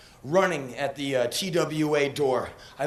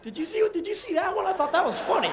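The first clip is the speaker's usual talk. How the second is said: mocking cabbies; 13 semitones higher, faster than usual. Waiting cabbies laughing at the soaked American. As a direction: gossip like amused cabbies.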